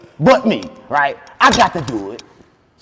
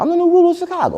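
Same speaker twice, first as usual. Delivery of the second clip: much higher, proud declaration; grand proclamation; 3 dB louder, faster than usual. Acted. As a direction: proclaim it like a king. Repeats claim grandly.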